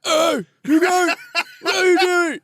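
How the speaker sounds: high, loud, drawn-out fake Scottish yell; bad Scottish accent. Wallace's head shooing people off the bridge, mock Scottish shout. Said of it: "don't have a good Scottish accent".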